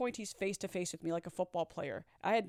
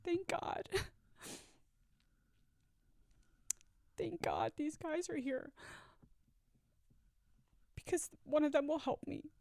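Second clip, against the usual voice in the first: high, small voice with long halting pauses; overwhelmed, high-pitched inner voice; desperate relief; 8 semitones higher, 4 dB quieter than usual. Retold or imitated. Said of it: "all I'm thinking at that moment".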